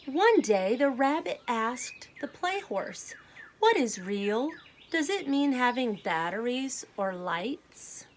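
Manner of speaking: clear, lively storybook read-aloud; children's story narration with gentle emphasis. Acted. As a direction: read it like a picture book to a class. Teacher reads picture book aloud.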